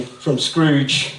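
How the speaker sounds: usual speaking voice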